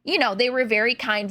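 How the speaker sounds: ordinary delivery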